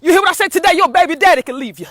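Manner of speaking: loud, high, rising church preaching; preaching at full force. Real, not acted. Urging listeners that God brings them back, preaching loudly. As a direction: preach it out to the congregation, building. Sometimes I get excited.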